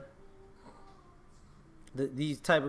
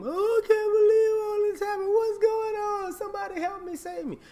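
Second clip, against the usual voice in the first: much higher, hammed-up whine; mock the fake victim begging for help; mock helpless victim; 4 dB louder than usual. Mocking people who play victim, in a much higher hammed-up whine. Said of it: "they turn victim".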